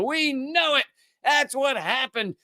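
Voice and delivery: high, smug mock certainty; mock smug claim. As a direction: parody a baseless claim. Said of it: "It's just Israel saying".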